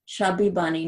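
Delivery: cheeks stuffed with marshmallows; barely getting words past a full mouth. Real, not acted. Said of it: "another hand of marshmallows".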